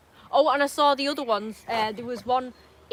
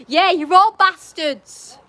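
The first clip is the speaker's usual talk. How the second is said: shouting; 5 semitones higher, 10 dB louder, much slower than usual. Real, not acted. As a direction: yell it out slowly. Yelling at kids outside.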